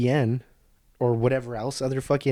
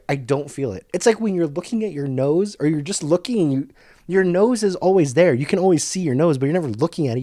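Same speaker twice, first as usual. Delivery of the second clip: usual speaking voice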